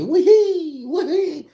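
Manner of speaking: high, giggly little "wee-hee" while teasing; mock girly giggle. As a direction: squeal it like a girl playing a prank. Mimics pregnant girl giggling over him.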